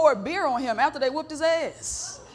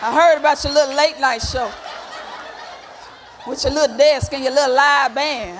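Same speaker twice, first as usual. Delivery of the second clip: louder, mock-condescending compliment; sprinkling shade; 5 dB louder than usual. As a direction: belittle while pretending to congratulate.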